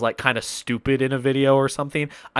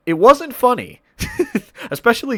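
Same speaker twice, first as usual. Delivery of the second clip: laughing; 11 semitones higher, 3 dB louder than usual. Real, not acted. Laughing at own joke.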